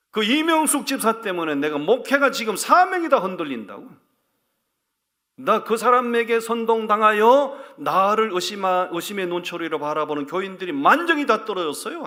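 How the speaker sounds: loud, high, indignant outburst with a bitter laugh; loud aggrieved complaint. Retold or imitated. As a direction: pour out your grievance to God, half shouting. Pastor vents to God angrily.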